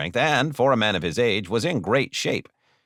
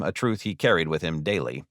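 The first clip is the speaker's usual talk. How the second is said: ordinary delivery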